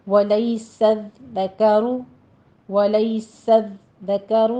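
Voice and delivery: ولیس الذکر with the dhal doubled and no hiss; ولیس الذکر recited slowly; slow model of ولیس الذکر; tajweed pronunciation. Shown as a demonstration. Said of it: "سیٹی کی آواز بالکل نہیں آنی چاہیے".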